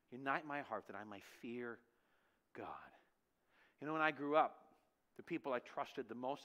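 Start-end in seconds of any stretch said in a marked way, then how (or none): 0.1-2.9 reverent reading. Shown as a demonstration. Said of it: "mentioned in the scripture".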